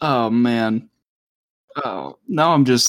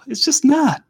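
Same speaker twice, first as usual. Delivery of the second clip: high indignant insistence. Real, not acted. Insisting guacamole is bad.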